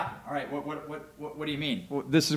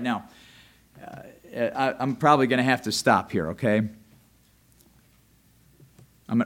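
ordinary delivery

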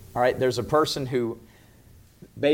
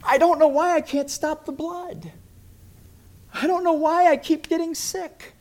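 desperate lament; 14 semitones higher, 6 dB louder than usual. Acted. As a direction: moan in helpless despair. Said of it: "and they're going".

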